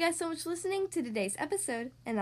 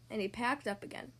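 usual speaking voice

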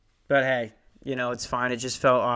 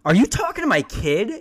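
loud, higher mom voice with a rising end; mom's accusing question; 8 semitones higher, 5 dB louder than usual. Retold or imitated. Mom demanding answers from the salesman, loud and rising. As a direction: demand it like a suspicious mother. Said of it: "she goes".

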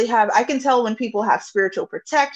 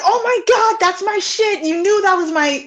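excited; 10 semitones higher, 3 dB louder than usual. Retold or imitated. Client's excited recognition.

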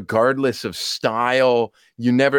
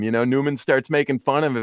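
normal talking voice

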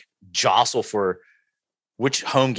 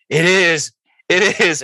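dazed, higher and louder repeat; starstruck; 8 semitones higher, 5 dB louder, slower than usual. Retold or imitated. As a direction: stammer it out, awestruck. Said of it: "all I can mutter".

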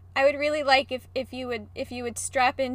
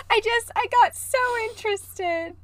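high and laughing, teasing a scared friend; giggling tease; 12 semitones higher than usual. Real, not acted. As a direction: laugh your way through the question. Teasing her scared co-host.

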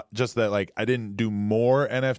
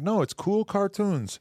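higher, slow, lightly hammed Canadian accent; imitating Logan Paul; 10 semitones higher, slower than usual. Mimicking Logan Paul shrugging off scam claims, slow and hammed up. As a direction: drag out cool cartoons like a dopey dodge. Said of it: "I'm trying to sound like Logan Paul".

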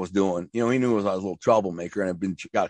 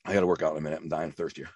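while sipping a drink; 7 semitones lower, 7 dB quieter, faster than usual. Real, not acted. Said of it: "I'm drinking a protein shake".

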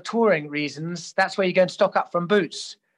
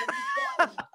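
high burst of laughter; 5 dB quieter than usual. Real, not acted. Laughing at suitcases.

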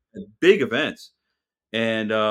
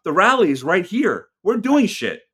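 high, louder mock tirade at the rally-goers; retold rant; 10 semitones higher, 5 dB louder than usual. Telling rally-goers the rally is here, a loud high rant. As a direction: throw it back at them, loud and high. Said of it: "I, I'd be like".

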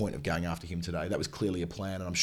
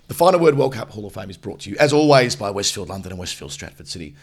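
sponsor read; 10 dB louder than usual. Acted. Sponsor read for Hall of Fame.

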